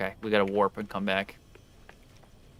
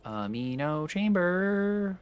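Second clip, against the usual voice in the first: slow drawn-out; 8 semitones higher, 6 dB quieter, much slower than usual. Real, not acted. Searching menu, drawn out.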